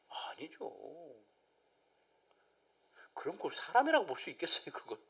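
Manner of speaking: soft unvoiced whisper; hushed no. Real, not acted. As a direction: whisper the answer. Preacher whispered answer.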